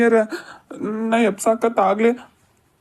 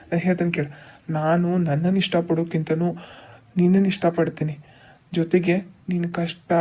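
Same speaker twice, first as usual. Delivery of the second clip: lower, softer loving promise; a husband softly vowing his love to his wife; tender vow; 5 semitones lower, 4 dB quieter than usual. Acted. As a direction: promise her gently, close and low.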